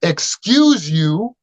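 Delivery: high, slow, drawn-out "Excuse you" with a rising tune; mock the indignant lady, stretching it out; a haughty stranger scolding someone who brushed past; snooty old lady. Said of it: "She goes".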